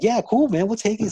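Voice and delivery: eager acceptance. Retold or imitated. Teen grabbing free pizzas from the worker, high excited yes. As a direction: act thrilled to grab the free pizza.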